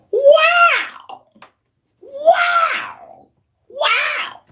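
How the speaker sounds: disbelieving shout of wow; stunned wow. Retold or imitated. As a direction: gasp out a shocked wow. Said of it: "that's what I'm like in my head".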